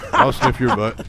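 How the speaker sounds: high punchline through a laugh; Fifi punchline. Retold or imitated. Comic finishing the Fifi bit, high and laughing. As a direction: land the dog gag with a laugh. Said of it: "then do Fifi with them".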